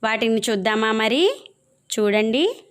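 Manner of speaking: usual speaking voice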